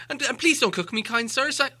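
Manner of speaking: high, stammering hobbit begging; frightened pleading. Acted. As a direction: beg the trolls not to cook him.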